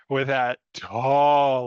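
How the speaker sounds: slow, stretched Texan drawl. Acted. Describing Doug Dimmadome's hat in a slow Texan drawl. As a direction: drag the words out in a Texas accent. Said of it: "I keep slipping to this accent".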